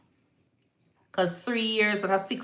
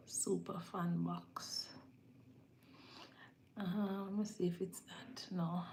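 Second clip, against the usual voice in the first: whispering to herself; 20 dB quieter than usual. Real, not acted. She reads the screen to herself in a whisper. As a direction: mutter it under your breath while reading.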